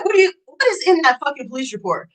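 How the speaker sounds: normal talking voice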